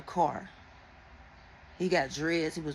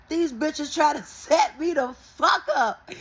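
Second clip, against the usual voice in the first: slow, louder, higher with a laugh mid-line; laughing indignation; 7 semitones higher, 6 dB louder, slower than usual. Real, not acted. Telling viewers about her cousin, she laughs indignantly. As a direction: laugh through it, slow and loud.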